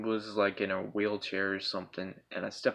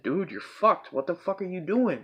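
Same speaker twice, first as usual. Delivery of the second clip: higher-pitched heated challenge; mock-angry demand, pitched up; retold confrontation; 7 semitones higher than usual. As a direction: act out confronting an intruder, voice raised in pitch. Retold confrontation while choking intruder.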